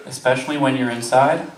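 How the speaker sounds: usual speaking voice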